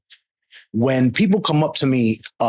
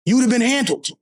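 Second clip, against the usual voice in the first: much higher, fired-up rant; heated outburst; 10 semitones higher than usual. Real, not acted. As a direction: get worked up about it. Heated about handling a man.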